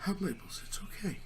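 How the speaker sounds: a gentle soft-spoken tree consoling; ancient tree voice. Acted.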